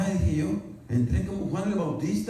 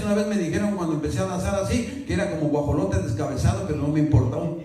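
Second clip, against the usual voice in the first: ordinary talk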